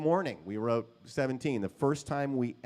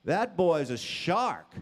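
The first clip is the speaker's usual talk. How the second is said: higher, louder, slow fatherly advice; lightly voiced father; 7 semitones higher, 5 dB louder than usual. Father advising his son about Kip, lightly voiced. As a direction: lay down a father's verdict. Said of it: "he said".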